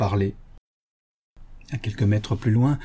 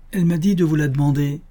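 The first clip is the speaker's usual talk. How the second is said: fast, higher insistence; hurried, whiny comeback; plaintive protest; 6 semitones higher, 4 dB louder, faster than usual. Acted. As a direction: protest quickly as the little man. Little man pleads in acted quarrel.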